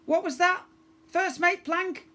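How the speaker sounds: high, scratchy pirate voice like a creaky gate hinge. A narrator's reading. First mate asking the girl in the smoke, high and scratchy.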